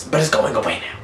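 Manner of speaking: normal talking voice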